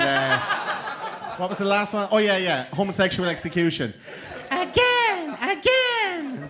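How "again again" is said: put-on high childish "again, again"; squeaky toddler chant; Teletubby voice.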